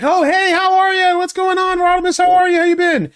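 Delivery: hammed-up friendly hello to a fan; high squeaky excited greeting; enthusiastic greeting. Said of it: "He's like".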